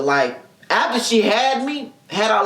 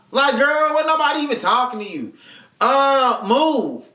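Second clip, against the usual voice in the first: usual speaking voice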